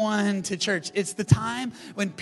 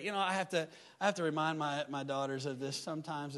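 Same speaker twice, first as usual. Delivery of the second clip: normal talking voice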